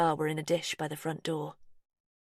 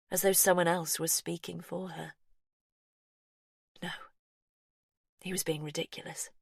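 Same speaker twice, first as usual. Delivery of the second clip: ordinary delivery, nothing marked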